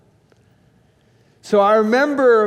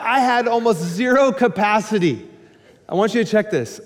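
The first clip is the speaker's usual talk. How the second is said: usual speaking voice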